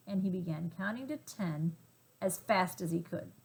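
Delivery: ordinary delivery, nothing marked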